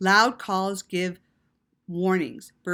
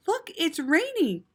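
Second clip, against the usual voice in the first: gasp of surprise; 8 semitones higher than usual. Acted. Spots rain in the story.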